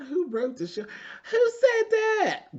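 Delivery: high, slow squeal of outrage; mock shocked Kandi. Imitating Kandi reading the card, shrill and stretched. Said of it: "Kandi was like".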